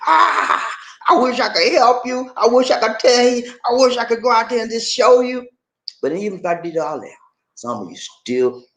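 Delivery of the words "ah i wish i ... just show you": theatrical wishing. Acted. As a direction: wail your wish with exaggerated yearning. Acted lament of wishing to help.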